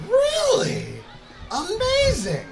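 gasp, then a very high thrilled Really; delighted surprise. Real, not acted. Host thrilled by a kid's taco praise, high delighted surprise. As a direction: act stunned with delight.

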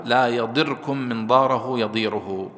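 slow "يضركم" as yadirkum, then long vowels in "ضاره يضيره"; lightened r. Shown as a demonstration. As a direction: keep ر single in "يضركم", i before it. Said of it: "وفي قراءة أخرى بالتخفيف". (تخفيف الراء وكسر الضاد في "يضركم")

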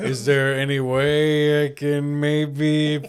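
high, slow pleading guy voice; whiny begging guy. Retold or imitated. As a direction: beg like a pushy boyfriend. Guy begging partner in mock voice.

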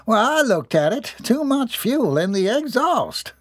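high country boast, drawn out at the end; proud. A narrator's reading. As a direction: show off your know-how proudly. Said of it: "Jeb announced proudly".

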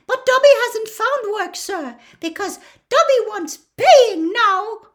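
high, loud squeaky elf voice; an elf proudly stating terms; proud house elf. A narrator's reading. Dobby telling Harry he wants pay, high and squeaky.

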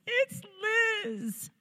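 crying out. Retold or imitated. Crying as she tells dad.

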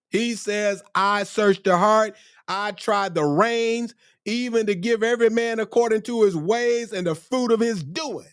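high, sustained scripture declamation; impassioned preaching. Real, not acted. Preaches the verse at full pitch.

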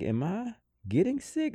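high-pitched self-questioning; retold worried thought. Recounting the first COVID symptoms to his co-host, high questioning thought. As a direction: voice jumps up high on an inner question. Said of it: "I started thinking, like".

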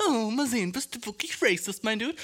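teasing taunt, retold; provoking challenge. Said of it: "wollte, dass die so ein bisschen so raus, ausfordern".

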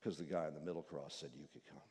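very low, soft, quick; just state the reason; humble thief. Acted. Thief repeats his answer.

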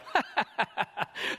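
mocking laugh. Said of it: "they look at him and they go".

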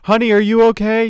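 a worried partner calling from upstairs. Retold or imitated. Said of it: "And then upstairs".

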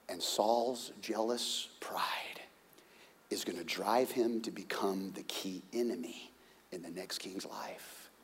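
slow, hushed foreboding whisper; ominous whisper. A narrator's reading. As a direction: drop to a grim whisper. Ominous turn in narration.

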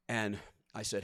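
usual speaking voice

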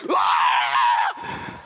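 short startled scream, low and forced; mock drowning yelp, cut off; panicked scream. Telling the church how he went under, a hammed-up scream.

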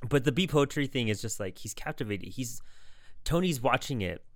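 usual speaking voice

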